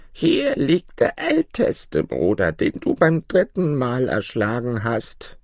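put-on high, slow old woman's voice; old forest woman. A narrator's reading. Bush mother shows boy her dead son, high slow accusation. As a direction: draw it out high as the crone. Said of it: "und die Alte sprach".

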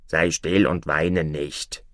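slow, low frog voice; calm the princess slowly, down low; unhurried talking frog, pitched low; soothing frog. Acted.

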